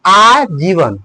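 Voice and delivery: आजीवन slowed, with a long stressed opening आ; stress on first syllable. Shown as a demonstration. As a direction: stretch the आ of आजीवन, then finish जीवन. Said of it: "आप बल लग रहा है". (आजीवन के आ पर लंबा बल)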